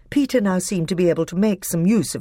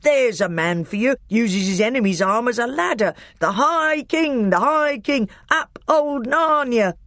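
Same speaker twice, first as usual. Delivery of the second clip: delighted cheer; 7 semitones higher than usual. A narrator's reading. Trumpkin dancing as the High King recovers, delighted high cheering. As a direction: crow with delight, building into a chant. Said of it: "dancing with delight".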